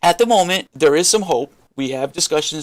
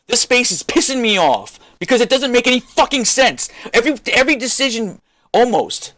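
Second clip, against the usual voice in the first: angry outburst; 8 semitones higher than usual. Real, not acted. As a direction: vent your frustration hard. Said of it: "let me calm down for a second".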